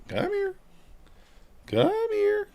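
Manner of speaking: very high coaxing call to the cat. Real, not acted. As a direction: sing it up high to lure a pet. Calling the cat over.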